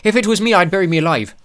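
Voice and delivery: high, loud, breezy dwarf voice offering his own burial; polite suggestion. Acted. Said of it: "made a polite suggestion".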